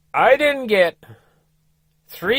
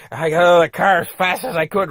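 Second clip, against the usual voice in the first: ordinary talk, nothing marked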